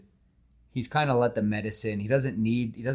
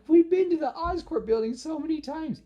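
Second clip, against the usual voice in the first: much higher, put-on girlish disbelief; incredulous Gwen; 5 dB louder than usual. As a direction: Gwen pressing Peter, squeaky and amazed. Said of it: "She's like".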